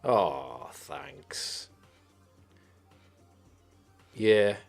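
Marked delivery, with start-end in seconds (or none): none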